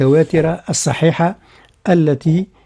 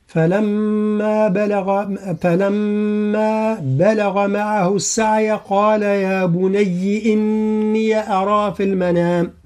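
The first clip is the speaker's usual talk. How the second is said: slow, higher Quranic chant; Quran recitation; 4 semitones higher, much slower than usual. Shown as a demonstration. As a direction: recite the verse with full tajweed. Recites the verse. (تلاوة مجودة بطيئة)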